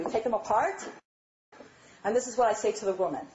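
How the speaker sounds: ordinary talk, nothing marked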